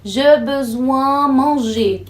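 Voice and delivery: slow, mock-learner Je besoin manger; wrong usage example. Shown as a demonstration. Teacher mimicking a learner's error. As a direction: say the wrong sentence slowly, like a learner. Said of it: "achar que besoin é um verbo e dizer".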